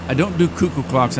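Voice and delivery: ordinary talk, nothing marked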